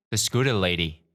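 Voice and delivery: ordinary delivery, nothing marked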